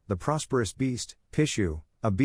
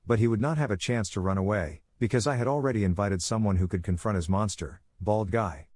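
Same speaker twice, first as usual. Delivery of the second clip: ordinary delivery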